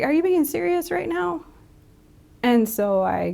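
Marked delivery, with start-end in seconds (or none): 0.0-1.5 much higher, incredulous comeback; retold incredulity. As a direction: reenact a disbelieving parent, pitch jumping up. Retold incredulous reply to her son.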